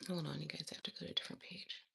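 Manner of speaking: fast hushed whisper; fast whispered aside. Real, not acted. Hushed aside while recording.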